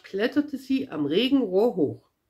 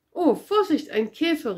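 a higher, lively warning call; 6 semitones higher than usual. A narrator's reading. Pippi warns Thomas not to step on the beetle, calling out. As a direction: sing out a quick warning. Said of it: "rief Pippi".

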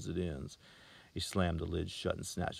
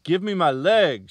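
slow, high, much louder scream; 11 semitones higher, 16 dB louder, slower than usual. A narrator's reading. Girl screaming at salesman for her leg, slow and loud. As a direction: scream it out in panic. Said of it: "she screamed".

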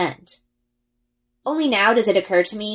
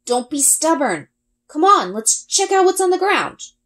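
coaxing; 9 semitones higher than usual. A narrator's reading. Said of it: "gritting my teeth as I try".

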